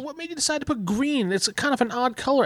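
high-pitched innocent chitchat; hinting small talk. Acted. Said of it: "that gives him kind of a hint".

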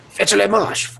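a dash of bad French accent; mock French. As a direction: bark the order in fake French. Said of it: "That's the only French I know".

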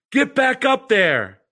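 big booming coach's yell, high and loud. Retold or imitated. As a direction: bellow it from the dugout. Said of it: "booming, echoing voice yelling".

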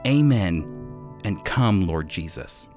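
ordinary talk

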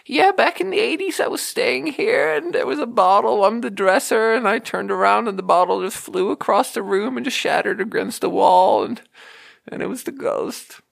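put-on high, quavering old lady telling a ghost story. To the comic, an old lady recalls her ghost, in a high old-lady voice. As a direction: mimic an old lady swearing it was a ghost. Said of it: "old ladies are always telling me".

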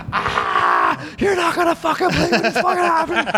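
very high screaming babble; hysterical panic. Retold or imitated. Storyteller re-enacts running to his rescuers, hysterical scream. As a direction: shriek it out as if terrified.

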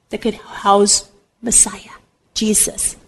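slow, whispered awe; reverent hush. Real, not acted. Speaker tells listeners whom Mary would carry, reverent whisper. Reverent climax naming Jesus.

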